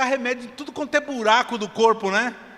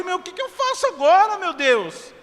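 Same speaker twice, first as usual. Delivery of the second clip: at wit's end; 11 semitones higher, 5 dB louder than usual. Retold or imitated.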